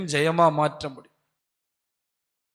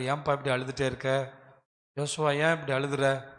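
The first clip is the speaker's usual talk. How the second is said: gentle question; 4 semitones lower, 5 dB quieter than usual. Acted. God gently questions weeping Joshua.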